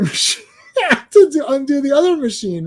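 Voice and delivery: high-pitched, breaking into laughter; incredulous laugh through the retold request. Retelling someone asking for an undo machine, laughing at it.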